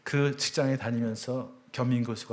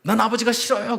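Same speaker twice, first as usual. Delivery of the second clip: put-on high, loud cry of a teenage son; a boy screaming at his father; sudden scream; 10 semitones higher, 10 dB louder than usual. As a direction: blurt it out like a single shriek. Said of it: "외마디 비명처럼 소리를 지르더라고요".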